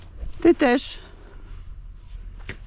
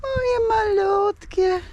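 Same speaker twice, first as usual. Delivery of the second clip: cooing; 9 semitones higher, slower than usual. Real, not acted. Woman cooing slowly and high to her little hens. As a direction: coo it slowly and high, like to babies.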